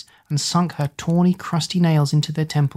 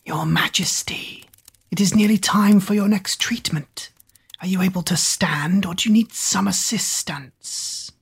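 breathy hushed servant voice; a fawning whisper; whispery servant; 3 semitones higher than usual. Acted. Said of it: "Googe popped his head around".

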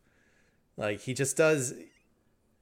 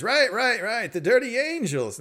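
put-on dismissive old man; 9 semitones higher than usual. Gramps brushing off correction.

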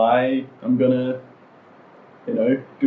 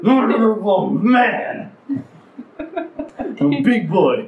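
high, drawn-out doting coo; slow, pitched-up fussing over a pet; doting pet talk; 9 semitones higher, much slower than usual. Shown as a demonstration. Showing how the little brother gets called adorable, slow high cooing. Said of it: "When I call him adorable".

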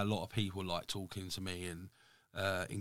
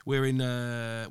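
ordinary delivery

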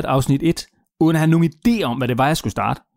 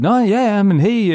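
higher, chirpy "Nå, ja, ja, men hey"; breezy upbeat reply; 6 semitones higher, 3 dB louder, slower than usual. Retold or imitated. Imitating Jesper's breezy answer to his email, higher and chirpy. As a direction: toss it off like a busy millionaire.

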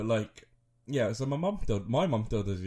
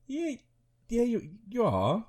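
put-on much higher sing-song yeah, teasing the child; teasing parent; 13 semitones higher, slower than usual. Dad teasing his son he is a baby, sing-song. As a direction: coo it back to him playfully. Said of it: "And I'm like".

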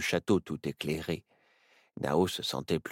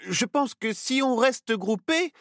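put-on a higher, child's voice, anxious and reassuring himself; a small boy talking himself into courage; nervous Nao; 5 dB louder than usual. A narrator's reading. Nao, frightened, reassures himself and the others: higher, anxious child's voice. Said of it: "se rassura Nao".

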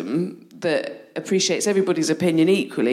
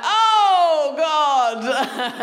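high shocked "oh God"; shocked gasp; 11 semitones higher, 4 dB louder than usual. Retold or imitated. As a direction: blurt it out in shock. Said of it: "he was like".

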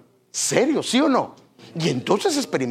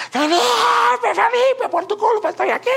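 much higher mock yell of a woman in labour; 5 dB louder than usual. Preacher imitating a woman in labour blaming Felipe, mock high yell. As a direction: scream at the husband like a mother giving birth, hammed up.

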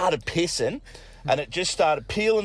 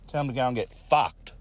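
ordinary delivery

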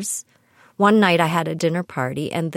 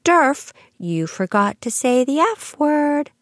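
imitating a small girl, higher and slow; mock the child scolding grandma, slow; high, slow child's correction; little girl; 9 semitones higher, 4 dB louder than usual. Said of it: "she said to her".

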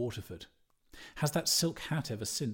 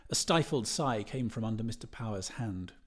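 plain reading voice

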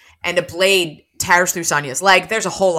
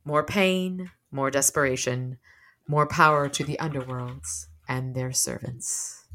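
said quietly; 4 semitones lower, 7 dB quieter than usual. A narrator's reading. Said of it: "Michael said quietly".

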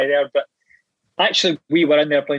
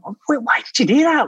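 much higher, panicked question; high-pitched alarm; 10 semitones higher than usual. Retold or imitated. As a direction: squeak it out in disbelief. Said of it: "and I was going".

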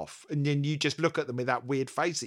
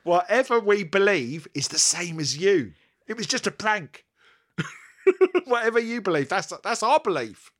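mock appeal; 6 semitones higher, 5 dB louder than usual. Mock announcement to offended listeners.

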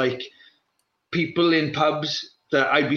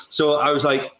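usual speaking voice

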